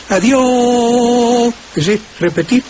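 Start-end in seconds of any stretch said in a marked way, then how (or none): 0.1-1.5 "Adiós" dragged long with accents on all its vowels; wrong stretched accent. Shown as a demonstration. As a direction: sing out "Adiós" stressing each stretched vowel. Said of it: "a ocurrírsele decir".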